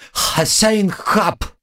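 high, very slow creature squeak; squeaky creature. Acted. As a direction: drag it out like a little critter. Pet cockroach speaks calmly.

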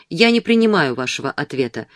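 ordinary delivery

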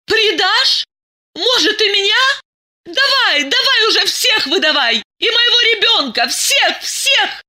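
very high, hysterical shouting; screaming in hysterics. Acted.

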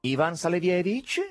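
obnoxious, high nasal caller. A narrator's reading. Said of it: "con un'antipaticissima voce nasale". (voce nasale antipaticissima)

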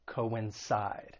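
coincide with the last syllable, CIDE, stressed; stress on third syllable. Shown as a demonstration. Teacher modelling the verb, coincide stressed on its third syllable. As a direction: say coincide slowly, leaning on the final syllable. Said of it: "The stress is on that third syllable".

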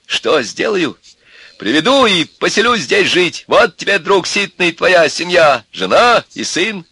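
high, loud, mounting tirade; defiant threat. A narrator's reading. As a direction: lay down the law angrily. Father answers with angry threats. (громкая угроза отца)

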